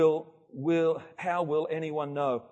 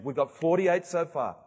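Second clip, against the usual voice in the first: ordinary delivery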